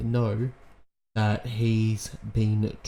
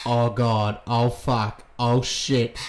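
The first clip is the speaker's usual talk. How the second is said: ordinary talk, nothing marked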